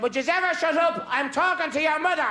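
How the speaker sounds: put-on high, tart old woman; granny scolds the painter pretending to be God; old granny's retort. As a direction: cut him off like a cross granny.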